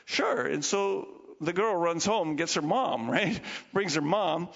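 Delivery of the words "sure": princess agreeing. Retold or imitated. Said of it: "the princess says".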